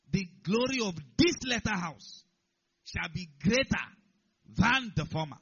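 loud, declaimed Bible verse; ringing scripture reading; preaching scripture. Real, not acted. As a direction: proclaim the verse in weighty, separated phrases.

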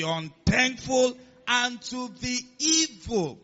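slow, weighty verse reading with drawn-out key words; emphatic scripture reading. Acted. Preacher reading Luke six to congregation, emphatic scripture reading. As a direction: declaim the verse, pausing before big words.

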